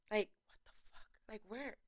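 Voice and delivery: breathy disbelieving whisper; stunned whisper. Real, not acted. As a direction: whisper it in shock.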